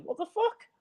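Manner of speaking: mock disbelief at the weather; baffled. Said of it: "You're like".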